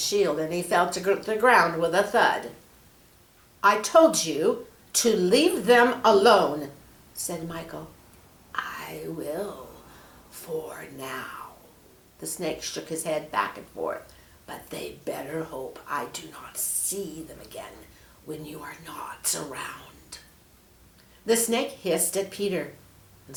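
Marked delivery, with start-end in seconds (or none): 3.6-6.7 loud, slow stern warning; stern rebuke. Acted. Angel Michael rebuking the snake, loud and slow. 8.5-20.2 snake whisper. Acted.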